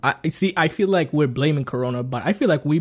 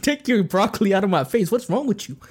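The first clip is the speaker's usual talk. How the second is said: higher-pitched, comic indignation; mock outrage; 6 semitones higher than usual. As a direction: scold the broccoli eater in playful disbelief. Acts out scolding a healthy eater.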